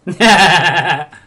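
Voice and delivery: loud, bleating burst of laughter. Real, not acted.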